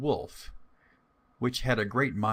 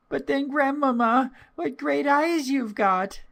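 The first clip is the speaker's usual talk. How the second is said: put-on much higher, louder little girl voice; 6 dB louder than usual. Girl at grandmother's door, high child voice. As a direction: answer brightly like a small child. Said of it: "said the little girl".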